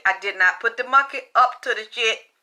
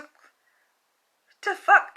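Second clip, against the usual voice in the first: drawn-out disbelief; 8 semitones higher, much slower than usual. Retold or imitated. Disbelief at monkey speaking.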